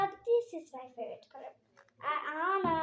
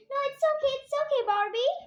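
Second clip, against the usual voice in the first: pretend doll voice; 8 semitones higher, 6 dB louder, much faster than usual. Doll voice reassuring Barbie.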